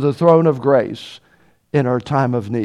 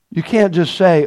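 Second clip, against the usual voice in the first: ordinary talk, nothing marked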